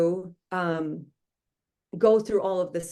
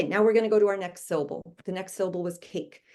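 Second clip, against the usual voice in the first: ordinary talk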